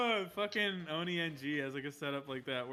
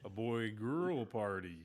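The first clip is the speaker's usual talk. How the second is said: robot Dale; 3 semitones lower, 4 dB louder, slower than usual. Retold or imitated. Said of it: "Robot Dale".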